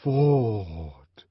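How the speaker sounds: long drawn-out word sinking down the scale; diminishing descending call. A narrator's reading. Synthetic voice intones to moved listeners, diminishing and descending. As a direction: intone it loud, then let it fall away. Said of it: "it said diminishingly and on a descending scale".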